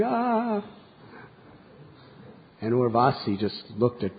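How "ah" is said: a high bleating scream, much higher; lamb's scream. A narrator's reading. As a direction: cry out like a frightened lamb. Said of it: "the two lambs were screaming and crying".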